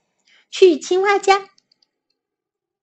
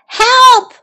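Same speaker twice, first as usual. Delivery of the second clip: high, loud cry of Help!; 10 semitones higher, 8 dB louder than usual. A narrator's reading. Toad cries out as his list blows away, loud high cry. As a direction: yelp for help as the list flies off. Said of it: "cried Toad".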